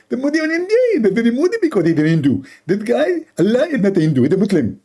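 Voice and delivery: high, fast mocking preacher; Zakir Naik impression. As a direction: rattle it off as a pompous cleric. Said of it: "Zakir Naik".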